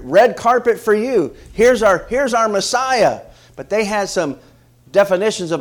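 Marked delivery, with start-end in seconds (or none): 0.0-3.2 high, loud, drawn-out welcome; slow, raised voice of a cheering crowd; acted crowd acclaim. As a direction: hail him like an adoring crowd. Voicing the crowd welcoming the Messiah.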